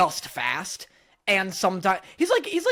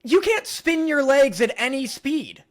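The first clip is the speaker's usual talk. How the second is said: louder, higher, emphatic protest; playful indignation; 5 semitones higher, 4 dB louder than usual. Real, not acted. Arguing with co-host about spinning legs, playfully indignant. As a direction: object loudly, half laughing.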